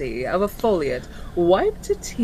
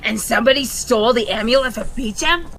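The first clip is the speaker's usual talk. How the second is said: cockney imp voice; 4 semitones higher, 5 dB louder, much faster than usual. Acted. Imp speaks in role.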